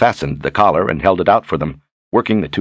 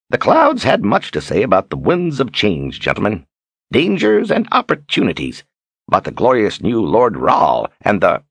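lilting, swooping showman's patter; string the audience along grandly; Zed, teasing cloud reader; 4 semitones higher than usual. Acted.

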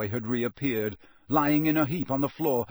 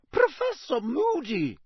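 high-pitched shocked exclamation; scandalised high voice; 13 semitones higher, 4 dB louder than usual. Acted. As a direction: cry out the name, aghast. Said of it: "said a shocked voice".

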